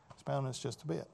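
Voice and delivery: soft repeat. Shown as a demonstration. Preacher softly repeating his point to the congregation, murmured. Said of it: "I want to expound on this".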